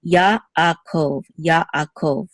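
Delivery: Yaakov split as "Ya" and "akov"; separated sounds. Shown as a demonstration. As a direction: stop briefly between the two a's of Yaakov. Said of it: "we're gonna separate the sounds".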